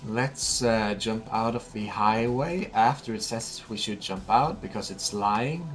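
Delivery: usual speaking voice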